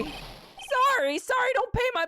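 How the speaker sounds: high-pitched, hammed-up complaint; mock whining retort. Game character complains to Nick about bills, mock whining. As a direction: throw the apology back in a high voice.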